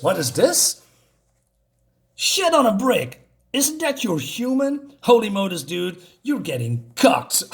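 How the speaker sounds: high, slow, drawn-out disbelief; mock astonishment. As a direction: gawk aloud in stretched-out shock. Robot gawks at rival's human.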